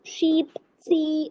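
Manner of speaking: शीप, सी with the ee drawn out slowly; long ee demo. Shown as a demonstration. Teacher modelling words for the class, drawn-out ee. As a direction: pull the ee sound long in सी. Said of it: "देखिए ई यो में highlight कर रही हूँ".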